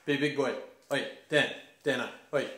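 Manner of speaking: put-on loud, blokey shouting at a kid to toughen up; tough bloke's shout. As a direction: bark it like an older country cousin. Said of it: "I'd immediately get".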